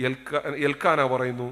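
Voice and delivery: ordinary delivery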